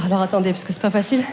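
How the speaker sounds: breathless from the sketch; out of breath. Real, not acted. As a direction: talk while catching breath. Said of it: "Je suis un peu essoufflée".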